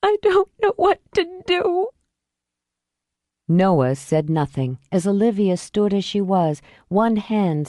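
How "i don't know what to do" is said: high, tearful wail; sobbing out her helplessness; broken, tearful plea; weeping. Acted. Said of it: "and wept".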